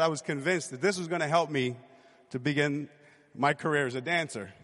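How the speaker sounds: ordinary delivery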